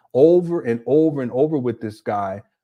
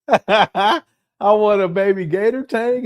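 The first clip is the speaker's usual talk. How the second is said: put-on higher, slow, louder childish plea; mock childish wish; 6 semitones higher, 5 dB louder, slower than usual. Mimicking the host's wish list, childish mock. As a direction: whine it like a spoiled kid. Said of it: "He just be throwing out".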